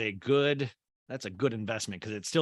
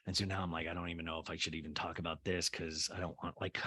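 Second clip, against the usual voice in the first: much softer, low embarrassed voice; hushed; 8 semitones lower, 12 dB quieter, faster than usual. Real, not acted. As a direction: drop to a near-whisper. Hushed self-conscious aside.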